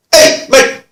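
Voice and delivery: high, loud attention-getting call; mock shout across the room. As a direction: imitate a rough bloke hailing the detective. Imitating offender calling out.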